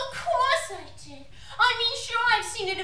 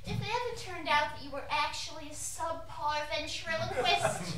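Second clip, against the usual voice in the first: menacing low threat; 7 semitones lower, 10 dB quieter than usual. Acted. Wife's sudden menacing threat.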